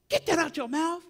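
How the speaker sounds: high, loud mock scolding; sharp scolding aunt. Aunt orders marble out of mouth, sharp scolding. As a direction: order the child like a stern grandma. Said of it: "that sharp voice".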